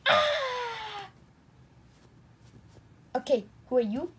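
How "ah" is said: long high scream sliding down. Pretend. Penguin toy keeps on screaming, long high scream. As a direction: scream it out and let it fall. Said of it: "Stop screaming".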